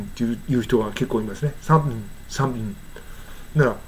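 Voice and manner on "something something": "something" reduced to sump'm; inaudible thing. Shown as a demonstration. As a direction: close the lips and swallow the end of "something". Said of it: "thingが聞こえない".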